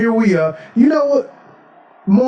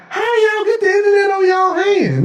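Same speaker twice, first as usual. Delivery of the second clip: high, incredulous exclamation; mock amazement. Modern human seeing internet on a hand, mock amazement. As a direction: pitch way up in put-on disbelief.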